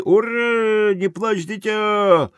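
high, drawn-out cheer; crowd cheering cry. Acted. Spectators cry out for their racer, high cheering shout. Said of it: "Крики".

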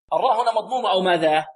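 ordinary talk, nothing marked

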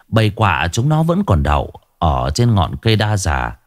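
ordinary delivery, nothing marked

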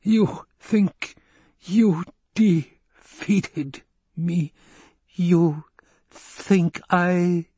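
high, slow, measured dying voice; dying doctor taunts the Baron, measured and slow; oddly measured cadence. A narrator's reading. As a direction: space every word with careful precision. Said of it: "his voice came in oddly measured cadence".

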